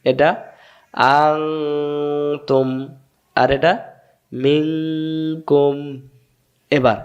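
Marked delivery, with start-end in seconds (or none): 1.1-2.7 আং held long and nasal before তুম; hidden nun. Shown as a demonstration. Teacher reviewing the example, long nasal আং then তুম. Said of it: "নুন সাকিন গোপন হবে". 4.3-5.8 মিং held long and nasal before কুম; hidden nun. Shown as a demonstration. Teacher reviewing the example, long nasal মিং then কুম. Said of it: "নুন সাকিন গোপন হবে".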